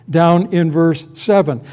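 normal talking voice

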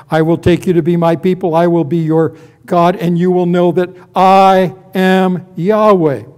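loud promise, quick then slowing for the name. Acted. God promises his people, proclaiming. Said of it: "repeat this statement four times".

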